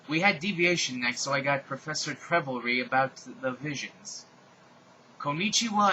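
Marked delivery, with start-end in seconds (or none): 5.2-5.9 high cheery konnichiwa; Japanese greeting. A narrator's reading. Teacher greeting class in Japanese, high and cheery. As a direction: sing out Konnichiwa brightly. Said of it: "said Professor Sinister in Japanese".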